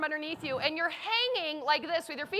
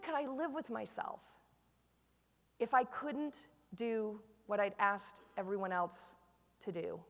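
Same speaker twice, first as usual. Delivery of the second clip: very soft, low and deliberate; hushed, earnest reflection; solemn self-questioning; 7 semitones lower, 11 dB quieter than usual. Retold or imitated. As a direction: ask yourself quietly, with pauses. Quotes her sober question to herself.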